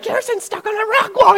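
extremely high mock squeal; panicked sisters. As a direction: imitate little sisters shrieking the news. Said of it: "my sisters are like".

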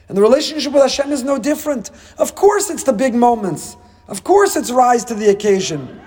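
high-pitched, sustained sermon fervour; impassioned preaching. Real, not acted. Preacher urging listeners to value small gifts, in impassioned preaching. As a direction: drive each phrase home from high in the voice.

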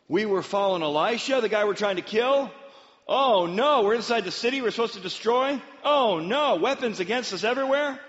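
high, loud alarmed wail; hammed-up rising panic; mock panic. Soldiers surrounded inside the enemy city, mock panic.

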